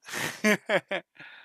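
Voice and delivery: bursts out in a high laugh; cracking up at own mock French; loud high laugh. Real, not acted. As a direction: laughs hard at own joke.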